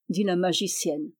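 ordinary delivery

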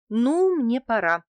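high, loud, stretched-out realisation; sudden drawn-out reminder to oneself; suddenly remembering. A narrator's reading. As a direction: realise with a start that it's time. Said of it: "спохватилась я".

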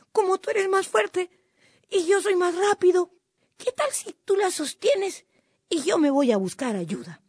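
high-pitched, innocent suggestion; storybook trickster making an offer; coaxing little cuy. A narrator's reading. Cuy coaxes fox into swapping places.

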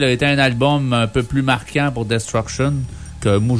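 ordinary talk

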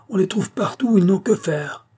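high, scornful heckling; yelled jeer. A narrator's reading. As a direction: complain shrilly from the crowd. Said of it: "hurla une voix". (récrimination criée, très aiguë)

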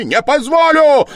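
very high, loud furious cry; shouting. A narrator's reading. Suspect shouting his refusal a second time, furious cry. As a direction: scream the refusal at the top of the voice. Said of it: "крикнул было другой раз".

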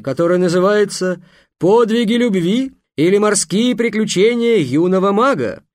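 loud, high, grand showman announcement; booming circus ringmaster. Acted. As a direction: proclaim it to a big crowd, slowed on titles. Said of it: "торжественно сказал". (громкий торжественный конферансье)

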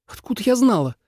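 high-pitched defensive comeback; snapping back. A narrator's reading. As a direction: protest testily in a high voice. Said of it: "огрызнулась Суэлань".

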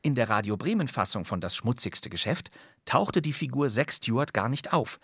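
ordinary talk, nothing marked

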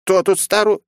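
put-on high, loud, indignant old woman's retort. A narrator's reading. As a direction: snap back at being called old. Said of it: "ругнулась было на него библиотекарша".

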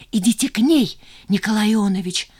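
fierce, urgent order in his ear; heated, pressing command; furious whisper. A narrator's reading. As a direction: order him urgently, half under the breath. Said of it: "яростно прошептала Жанна".

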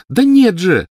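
put-on high, loud boyish cry; shouting after someone. A narrator's reading. Snape calling after the offended Lily, shouting. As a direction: call out in protest as she leaves. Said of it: "крикнул Снег ей вслед".

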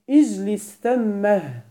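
"اجلس ثمه" very slow, closing on an audible h; pausal ha ending. Shown as a demonstration. Teacher shows students a permitted pause, "ثمه" ending in audible h. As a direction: draw out "ثمه" with doubled mim and breathed final ha. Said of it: "يوقف على ثم بهاء السكت".

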